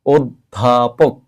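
অধ্যাপক starting on a closed ও, slowly split by syllable; correct pronunciation. Shown as a demonstration. Teacher showing students, অধ্যাপক slowly with closed o. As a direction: sound out ওদ-ধা-পোক slowly for অধ্যাপক. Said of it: "আমরা উচ্চারণ করব".

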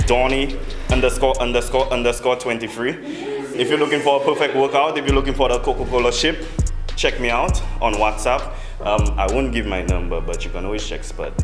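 normal talking voice

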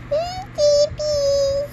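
high, slow puppet voice; bunny voice. Acted. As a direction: voice the toy bunny. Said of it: "Bunny head say".

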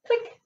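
tiny high-pitched "click"; light-switch click. Shown as a demonstration. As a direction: pop it out like a switch sound. Sound effect for the light switch.

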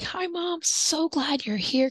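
bright welcome. Shown as a demonstration. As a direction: greet mom like a welcome guest. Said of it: "And I'm like".

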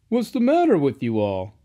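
hearty driver asking what is wrong; driver's voice. A narrator's reading. Driver questions the sad engines.

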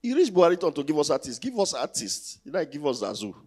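usual speaking voice

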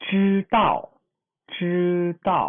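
จื้อเต้า said high and loud, retroflex จื้อ then falling เต้า; model word. Shown as a demonstration. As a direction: call out จื้อเต้า clearly for students to copy. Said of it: "ยกตัวอย่างเช่น".

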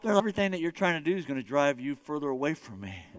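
gentle voice of Jesus. Acted. Pastor voicing Jesus answering the striver, low and gentle. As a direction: brush it off calmly and low. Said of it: "God's come".

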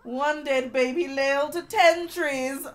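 slow punchline, breaking into laughs; laughing punchline. Real, not acted.

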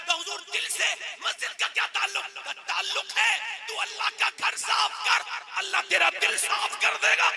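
high, loud shouted sermon climax; preaching at full force. Acted. Preacher to congregation about sweeping the mosque, shouting. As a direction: thunder the lesson out, rising to a shout.

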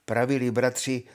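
plain reading voice

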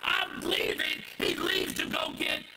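loud, high, driving sermon voice; preaching at full force. Acted. Preacher to congregation, shouting the disciples' outrage. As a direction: preach it hard, voicing the disciples.

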